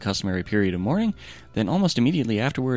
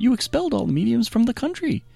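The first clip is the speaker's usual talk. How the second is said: a high, timid servant, much higher pitched. A narrator's reading. Servant answers the king in a high timid voice.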